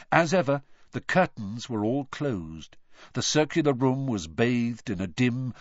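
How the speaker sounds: ordinary delivery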